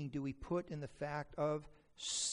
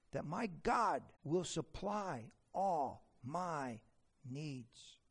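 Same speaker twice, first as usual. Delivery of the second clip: ordinary talk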